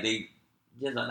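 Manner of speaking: normal talking voice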